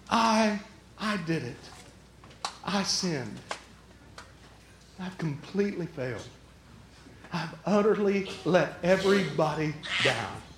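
low, soft admission with long pauses; humbled confession. Acted. Sinner owning up to failing everybody, quiet, humbled confession.

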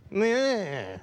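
drawn-out tongue-out jeer; mocking "meh". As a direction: bleat a long "meh" imitating scoffers. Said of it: "critical and sticking their tongue out".

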